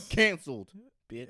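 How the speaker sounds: loud sassy brush-off; sassy loud dismissal. Acted. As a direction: dismiss them loudly.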